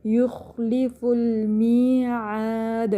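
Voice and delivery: slow chanted یخلف المیعاد, fa linked straight into laam; joined recitation. Shown as a demonstration. Teacher shows the linking, یخلف المیعاد recited slowly as one phrase. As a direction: join یخلف المیعاد with short "fu" and long later vowels. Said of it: "فا کو لام سے ملا لیتے ہیں".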